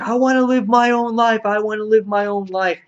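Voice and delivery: high-pitched mock protest; pleading young woman. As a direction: put on a high, insistent voice. Acting out Jean Grey's protest.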